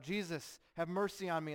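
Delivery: loud, high plea for mercy; crying out. Acted. Blind man crying out to Jesus over the crowd, loud high plea. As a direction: call out over the crowd to be heard. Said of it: "the man who cries out".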